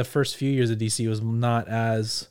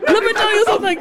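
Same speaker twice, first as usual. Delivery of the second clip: imitating the soundboard clip; 6 dB louder, faster than usual. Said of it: "I love that guy".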